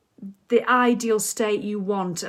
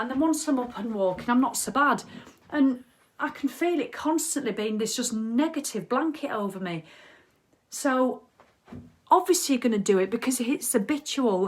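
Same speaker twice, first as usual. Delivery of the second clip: ordinary delivery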